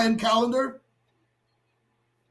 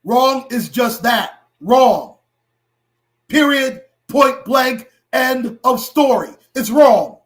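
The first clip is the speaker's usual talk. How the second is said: louder, hammering each short sentence; loud emphatic rant; 2 semitones higher, 5 dB louder, slower than usual. Real, not acted. Vlogger ranting to viewers about injustice, loud and emphatic. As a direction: slam every word down hard.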